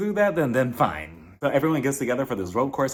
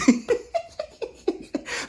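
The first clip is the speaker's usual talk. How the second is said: high airy laugh. Real, not acted. Laughing at own joke.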